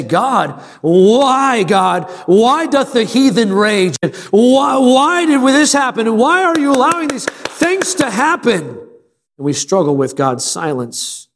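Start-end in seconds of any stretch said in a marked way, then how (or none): 0.0-8.7 pleading cry to heaven. Retold or imitated. Preacher voicing the heart's cry to God, anguished pleading. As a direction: cry out to God in rising despair. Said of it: "we look at the heavens and we say".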